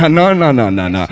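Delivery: usual speaking voice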